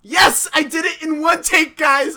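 high, loud cheer; excited shout. Real, not acted. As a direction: shout in triumph. Celebrating finished ad.

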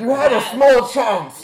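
high, loud teasing shout; gleeful yell at a bad roll; loud jeer. Real, not acted. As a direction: crow it over a failed roll. Jeering at the failed roll.

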